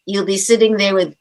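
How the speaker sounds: ordinary talk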